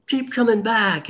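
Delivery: high, hammed-up call of encouragement; mock bullhorn cheer. The AA crowd urges newcomer Tom on, high mock cheer. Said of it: "again, the bullhorns".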